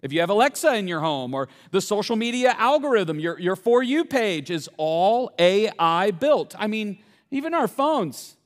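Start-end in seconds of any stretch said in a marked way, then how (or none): none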